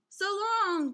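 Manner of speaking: high buzzing bee farewell; buzzed. Acted. Bees saying goodbye to the kids, high and buzzing. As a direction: buzz it out like a swarm. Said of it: "buzzed the bees".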